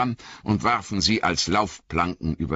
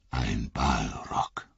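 much lower, slow, much softer murmur of "Ein Balrog"; Gandalf murmuring; 9 semitones lower, 7 dB quieter, much slower than usual. Acted. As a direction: breathe it out in grim recognition. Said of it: "murmelte Gandalf".

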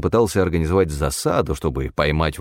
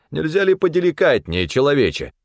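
high, indignant character voice; indignant protest; 8 semitones higher than usual. A narrator's reading. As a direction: object sharply in a raised pitch. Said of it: "возмутился Стефан". (возмущённо, высоким голосом)

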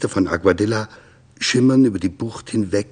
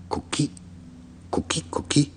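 soft, breathy koki frog calls; frog call imitation; 7 semitones higher, 8 dB quieter than usual. Narrator imitating tree frogs by the bay, soft croaking calls. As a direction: mimic the tree frogs quietly.

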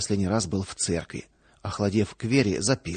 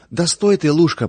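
calling out; 6 semitones higher, 8 dB louder than usual. Acted. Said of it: "пыталась удержать её Катерина".

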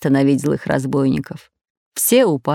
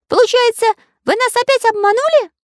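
Karandash's voice; 3 dB louder than usual. A narrator's reading. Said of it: "говорил Карандаш".

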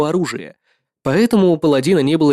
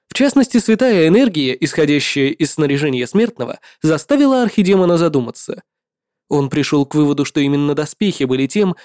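usual speaking voice

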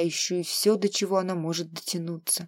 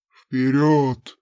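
low, loud barked order; impatient command; 6 semitones lower, 6 dB louder than usual. Acted. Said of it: "нетерпеливо потребовал Хараун". (нетерпеливый громкий приказ)

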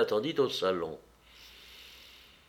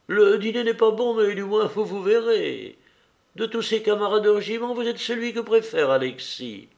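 much higher, lively hostess voice; hostess pressing an invitation; 8 semitones higher, 4 dB louder than usual. A narrator's reading. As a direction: talk the guest into coming, pitched up. Narrator voices Anna inviting. (hôtesse qui insiste, voix nettement plus aiguë)

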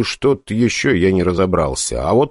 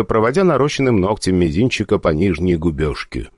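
plain reading voice

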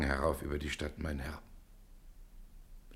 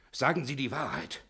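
shouting into his ear; 6 semitones higher than usual. Acted. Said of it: "und schreit ihm ins Ohr".

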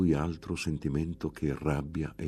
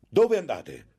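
grido alto e forte del monaco; strillo acuto; 10 semitones higher, 6 dB louder than usual. A narrator's reading. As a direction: strilla dietro alla ragazza che fugge. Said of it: "strillò". (urlo acuto e imperioso)

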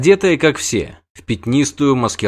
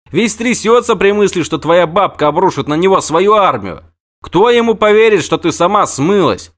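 high, loud, heated telling-off; the wounded knight scolds Ciri about the scandal she caused; agitated rant; 8 semitones higher, 4 dB louder than usual. Acted. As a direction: pour it out in an indignant rush. Freixenet ranting.

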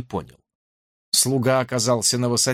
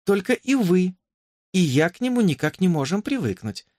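decisive; 6 semitones higher than usual. A narrator's reading. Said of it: "решительно закончила".

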